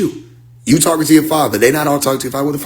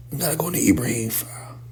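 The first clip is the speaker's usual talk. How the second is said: whispered aside while scrolling; whisper to self; 11 dB quieter, faster than usual. Real, not acted. Whispering to himself while scrolling for the Ibrahim file. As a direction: barely voice it, eyes on the phone. Muttering while searching phone.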